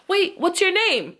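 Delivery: high, slow eager question; calling after her. Retold or imitated. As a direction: call out to her as she leaves.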